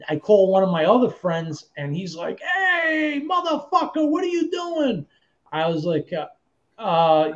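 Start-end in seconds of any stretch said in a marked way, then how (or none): 2.4-5.1 high, drawn-out mock holler; big sing-out hello, pitched way up; boisterous friend greeting. Friend's boisterous phone greeting acted.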